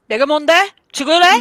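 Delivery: high, loud, taunting Korean challenge; with attitude. Shown as a demonstration. Teaching friends to talk back in Korean, loud and cocky. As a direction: snap it like a street threat. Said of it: "say it with attitude".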